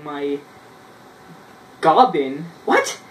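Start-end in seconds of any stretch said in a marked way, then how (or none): 2.6-3.0 sudden high squeak of a what; startled what. Real, not acted. Reading his old story, startled by a word, high squeak. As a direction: jump up in pitch, baffled. Confused by his childhood misspelling.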